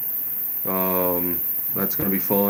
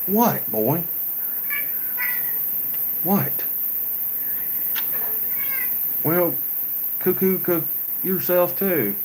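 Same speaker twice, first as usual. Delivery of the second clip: high-pitched pet talk; 10 semitones higher than usual. Real, not acted. Owner breaks off to answer his cat, high pet-talk voice. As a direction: answer the animal in a playful, much higher voice.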